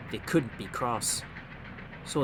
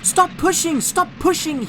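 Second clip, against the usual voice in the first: high, louder urgent plea; hissed plea; 9 dB louder than usual. A narrator's reading. Thomas begging the trucks to stop pushing, urgently. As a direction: beg them to stop. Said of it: "he hissed".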